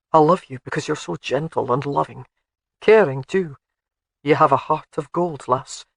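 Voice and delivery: light Scottish burr, fond and teasing; a Highland laird teasing his bride; Scottish husband Alec. Acted. Alec speaks to Jamie.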